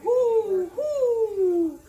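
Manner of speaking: high, loud owl hoots; hollering owl. Acted. Outraged owl hollering, high loud hoots. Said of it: "who hollered".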